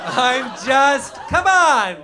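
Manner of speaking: loud, high outburst; exasperated shout. Real, not acted. Comic protesting to the audience, exasperated shout.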